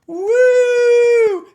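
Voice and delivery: very high, loud imitated woo; crowd whoop. Coach imitating the audience, loud high woo. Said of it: "the audience goes".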